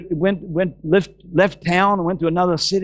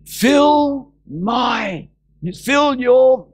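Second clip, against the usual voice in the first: very slow, higher proclamation; preach; 6 semitones higher, much slower than usual. Acted. Preacher proclaiming scripture to the congregation, very slow and raised. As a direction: stretch out each word solemnly.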